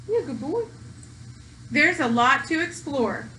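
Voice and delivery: ordinary delivery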